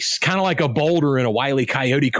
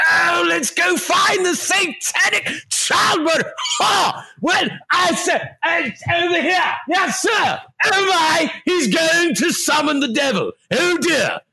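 high, frantic mock-English voice; hammed-up farcical Englishman in a panic; Basil Fawlty; 13 semitones higher than usual. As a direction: shriek it like a flustered sitcom hotelier. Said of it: "you might as well have Basil Fawlty".

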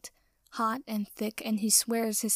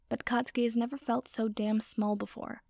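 ordinary delivery, nothing marked

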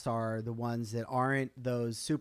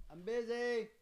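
calling out; 11 semitones higher, 4 dB quieter than usual. Real, not acted. Host to kids knocking at the door, calling out. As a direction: call it out high, away from the mic. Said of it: "Let me just tell them I'm busy right now".

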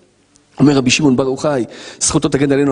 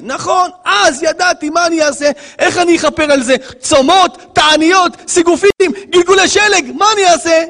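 high, frantic acted pleading; desperate sinner; 14 semitones higher than usual. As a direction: wail the list of penances helplessly. Acted sinner pleads about atonement.

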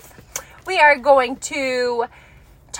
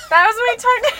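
high wail breaking into laughter; laugh-crying; 11 semitones higher, 3 dB louder than usual. Real, not acted. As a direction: squeak it out through laugh tears. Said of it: "sad tears or, like, laugh tears".

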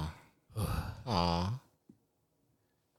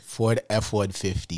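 slow, low ad-slogan voice; mock truck advert; 6 semitones lower, 5 dB quieter, slower than usual. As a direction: intone it like a truck commercial, slowly.

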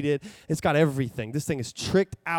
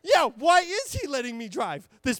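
panicked realization; 11 semitones higher, 3 dB louder than usual. Retold or imitated. Realizing he might be smuggling drugs, high panicked voice. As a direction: wonder aloud in rising panic. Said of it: "And I start to panic".

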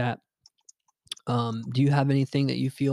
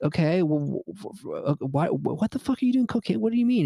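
flustered disbelief; 6 semitones higher than usual. Retold or imitated. Girlfriend admits doing cocaine.

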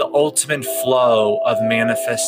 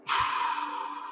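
audible breath out; 9 dB quieter than usual. Acted. Exhale after breathe out.

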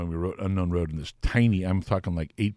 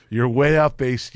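slow hammed-up correction, louder; mock rocker, slow and louder; 4 semitones higher, 7 dB louder, slower than usual. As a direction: drag it out like a know-it-all. Dokken voiced.

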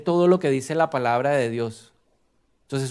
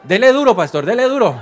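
loud, high call of encouragement; real shout from the pews urging him on; cheering shout; 8 semitones higher, 10 dB louder than usual. Real, not acted. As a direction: egg the preacher on. Congregation cheering pastor on.

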